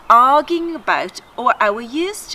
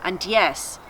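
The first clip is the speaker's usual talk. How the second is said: ordinary delivery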